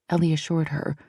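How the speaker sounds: ordinary delivery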